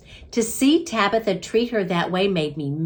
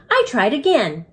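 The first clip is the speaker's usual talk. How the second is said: ordinary delivery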